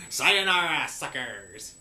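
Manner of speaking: put-on villain voice. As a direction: sign off like a gloating villain. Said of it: "in a voice, he says".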